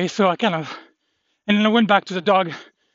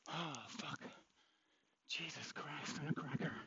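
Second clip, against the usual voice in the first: breathy whispered swearing; 25 dB quieter than usual. Real, not acted. Shaken after dog fight.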